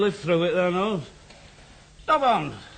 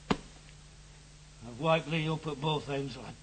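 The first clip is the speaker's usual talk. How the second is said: put-on soft drunken tenderness; 5 semitones lower, 10 dB quieter, slower than usual. Drunk father recalls raising son.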